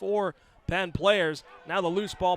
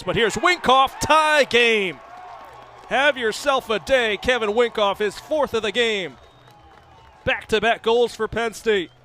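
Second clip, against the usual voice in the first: big high shout for the tying goal; excited goal call; 7 semitones higher, 11 dB louder than usual. Real, not acted. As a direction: belt it out, the game is tied. Announcer calls the tying goal.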